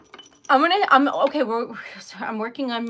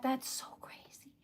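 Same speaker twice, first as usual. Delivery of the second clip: stunned breathless whisper; 13 dB quieter than usual. Real, not acted. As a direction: whisper it in disbelief. Stunned by donation.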